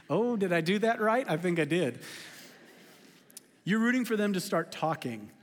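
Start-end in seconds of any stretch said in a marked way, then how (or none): none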